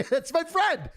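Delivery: delighted laughing repeat; giddy high laugh on the words. Real, not acted.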